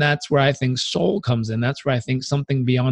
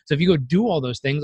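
usual speaking voice

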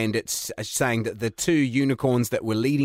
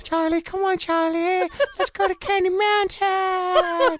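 much higher, slow whiny cartoon unicorn; slower than usual. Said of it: "we just acted out the entire thing".

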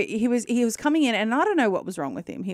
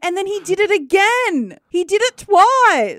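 excited insistence; 10 semitones higher, 5 dB louder than usual. Real, not acted. Host tells co-host the dog spoke twice, excitedly insistent. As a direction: insist on the astonishing repeat. Thrilled the dog did it again.

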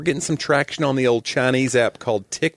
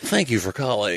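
voicemail greeting voice; 3 semitones lower, 4 dB quieter than usual. Retold or imitated. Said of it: "Your voicemail has a very Midwestern".

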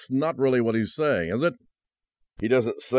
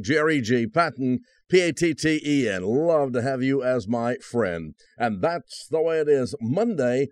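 raised, bright radio outro; cheerful host closing announcement; announcer sign-off; 6 semitones higher than usual. Acted. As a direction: sign off the show to listeners.

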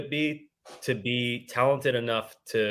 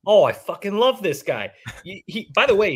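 excited; 9 semitones higher, 5 dB louder than usual. Real, not acted. Delighted reaction to the story.

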